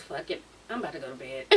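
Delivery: low, soft laugh-sigh; quiet laughing give-up. Real, not acted. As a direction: mutter it while chuckling.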